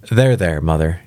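usual speaking voice

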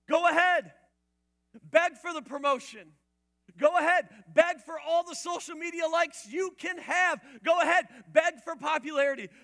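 high, loud mocking sermon challenge; preaching at full force. Preacher mocking the enemy's indifference, loud preaching. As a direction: hammer each go ahead.

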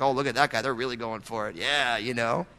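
low, hushed gossip about a fellow worshiper; judgmental aside. Acted. Churchgoer commenting on someone worshiping, hushed judgmental aside. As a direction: mutter it low and soft, like a sneering onlooker.

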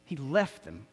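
low, soft and sorrowful; saddened. Real, not acted. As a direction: repeat it quietly, letting the sadness sink in. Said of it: "my heart was sad".

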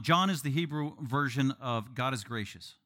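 ordinary delivery

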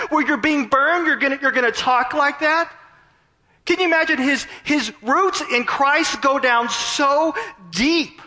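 very high, impassioned astonishment; incredulous amazement. Real, not acted. Preacher asking who talks like that while being burned, incredulous.

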